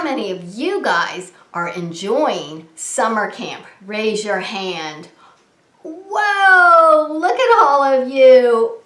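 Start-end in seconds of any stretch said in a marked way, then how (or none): 5.8-8.8 loud, high delighted gasp of wonder; be thrilled at the sight; amazed whoa. Acted. Host amazed at all the raised hands, loud whoa.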